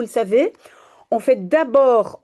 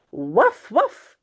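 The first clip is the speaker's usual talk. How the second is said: dog barking. Acted. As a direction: bark like a little dog. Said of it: "zegt Taki". (aboiement de petit chien imité)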